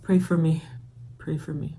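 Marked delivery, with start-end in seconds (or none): 1.2-1.8 a small, quiet appeal for support; hushed plea. Real, not acted. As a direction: say it much more quietly, almost to yourself. Plea repeated under the breath.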